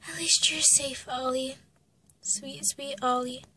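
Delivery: soft, breath-led words, slowing; tender murmur. Acted. Mother soothes baby Ollie, tender murmur.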